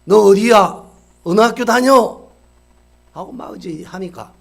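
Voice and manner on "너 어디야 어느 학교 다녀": much louder, barking questions; angry shout. Retold or imitated. Angry shop owner interrogates the caught students, shouting. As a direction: yell at the kids demanding answers.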